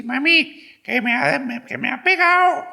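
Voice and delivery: put-on high-pitched yelling Spanish kid; hammed-up little-kid whine, very high and loud; loud Spanish child. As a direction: wail it out like a tattling child from Spain. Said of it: "hasta los niños hablan así".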